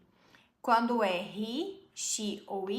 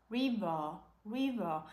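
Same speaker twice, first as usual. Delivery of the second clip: British river. Shown as a demonstration. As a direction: say "river" the British way, r pulled back. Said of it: "Ele fala, oh".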